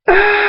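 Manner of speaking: long, very high shriek; sustained acted scream; falling scream. Opening the chapter after the cliff collapses, long high scream.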